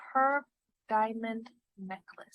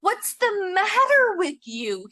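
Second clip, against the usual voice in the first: faltering; 8 semitones higher, 7 dB louder than usual. A narrator's reading. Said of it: "he faltered".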